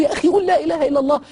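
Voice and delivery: high, loud, fast exasperated urging; angry plea. Retold or imitated. Friend losing his temper with the dying man, high loud urging. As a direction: lose patience and push it up high. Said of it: "اتعصبت عشان قلت له".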